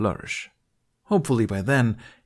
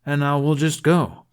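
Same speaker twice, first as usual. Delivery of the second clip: loud, higher dismissive retort; brusque; 7 semitones higher, 6 dB louder than usual. Acted. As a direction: shut him down flatly. Bunny brushing off Jack.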